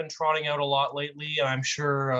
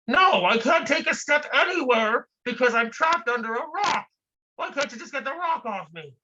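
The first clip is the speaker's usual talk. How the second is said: trapped man, impatient; 10 semitones higher than usual. Acted. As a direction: brush off the lecture, just get me out.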